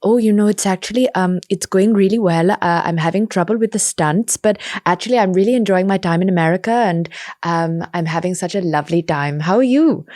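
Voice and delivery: put-on light Anglo-Indian accent, chatty and polite; a mild Anglo-Indian accent for small talk. Posing as Priyanka Chopra for the director, light Anglo-Indian accent. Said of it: "I put on her Anglo-Indian accent".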